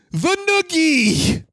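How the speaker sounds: drawn-out, high announcer call; ring announcer. Pretend. Announcing the finishing move.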